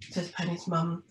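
ordinary delivery

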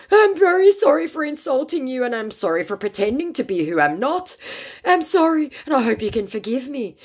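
mock pleading apology. Scammer grovels to narrator for forgiveness, high whiny voice.

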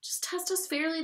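high-pitched indignant protest; whiny exclamation; exclaiming. A narrator's reading. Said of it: "they exclaimed".

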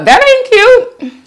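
high, slow sassy drawl; sassy put-down. Real, not acted. Judging how restaurants pile shrimp on top, slow sassy put-down. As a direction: drag it out with full sass.

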